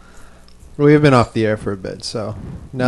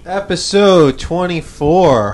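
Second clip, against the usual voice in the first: show announcer; 5 semitones higher, slower than usual. Acted.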